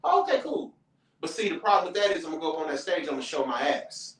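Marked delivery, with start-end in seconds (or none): 0.1-0.7 high, brushing-off okay; dismissive. Retold or imitated. Snobby comic brushing off a newcomer, high and dismissive. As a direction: wave him off like you barely heard. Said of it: "The ones that not".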